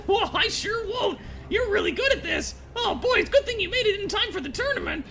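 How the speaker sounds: very high, quick goblin voice, impressed and eager; an admiring little goblin gushing over the player; cheerful goblin. Acted. Goblin keeps talking.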